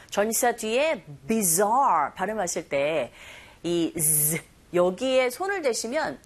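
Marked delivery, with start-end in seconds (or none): 1.3-2.1 "bizarre" with a long voiced buzzing z. Shown as a demonstration. Teacher models the word, "bizarre" with a bee-like buzzed z. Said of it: "벌이 날아갈 때 들리는 소리처럼". 4.0-4.4 "z" as a held voiced buzz; bee-like buzz. Shown as a demonstration. Teacher isolates the sound, a buzzed "z". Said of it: "벌이 날아갈 때 들리는 소리처럼".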